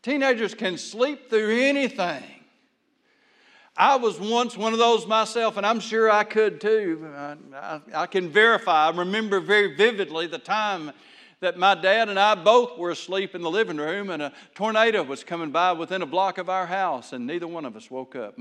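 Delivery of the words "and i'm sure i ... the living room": ordinary talk, nothing marked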